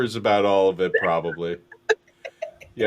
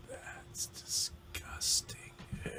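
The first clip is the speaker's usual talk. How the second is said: slow, very faint whisper; ghostly whisper; 18 dB quieter, slower than usual. Acted. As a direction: whisper it drawn out, like an unseen spirit. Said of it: "You hear a whisper that just goes".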